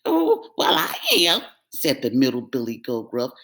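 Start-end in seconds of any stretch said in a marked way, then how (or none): 0.1-1.4 worried cry. Acted. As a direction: dread the troll. Middle goat voice.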